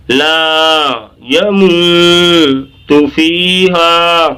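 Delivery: slow chant of لا یموت فیہا with held vowels; stretched recitation. Shown as a demonstration. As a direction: stretch laa, moo, fee and haa in لا یموت فیہا. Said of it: "اب اس طرح سے اسے کھینچیں".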